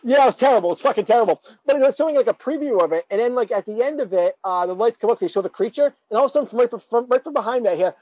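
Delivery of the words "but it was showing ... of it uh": usual speaking voice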